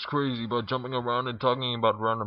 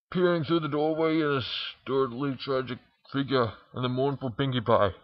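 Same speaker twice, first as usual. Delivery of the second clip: ordinary delivery, nothing marked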